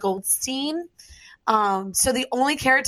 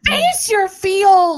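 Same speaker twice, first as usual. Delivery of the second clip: high, loud, drawn-out proclamation; mock slogan; 10 semitones higher, 7 dB louder, much slower than usual. Friend proclaiming a new hashtag, loud and drawn out. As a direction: announce it like a grand rally chant.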